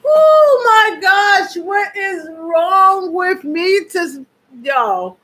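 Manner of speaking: high, loud, slow words through real laughter; can't stop laughing at your own slip; gasping laughter on every word; laughing hard. Real, not acted. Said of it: "from laughing so hard".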